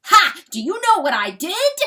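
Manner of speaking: high, loud, scornful shout; angry challenge. Acted. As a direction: fling it out as a furious challenge. Rooster challenges the coyote.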